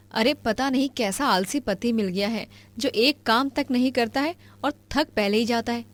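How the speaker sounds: ordinary talk, nothing marked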